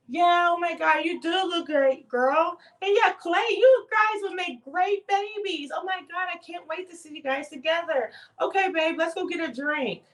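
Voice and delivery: loud, high, over-friendly gushing; gushing girlfriend act. Pretend. Voicing what she could have said to them, gushing. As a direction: play the hyped-up, supportive girl.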